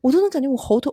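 ordinary talk, nothing marked

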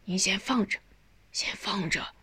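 soft, hushed demurral; gentle refusal. A narrator's reading. Son declining dying mother's savings, gently. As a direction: quietly put off accepting it.

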